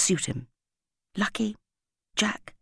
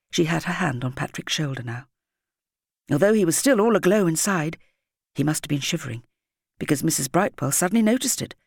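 ordinary delivery

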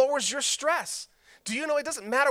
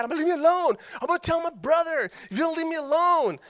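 put-on upset kid; 6 semitones higher, faster than usual. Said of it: "And I'm getting mad".

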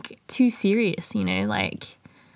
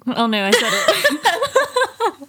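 laughing at herself; 8 semitones higher, much slower than usual. Real, not acted. Catches herself saying like again.

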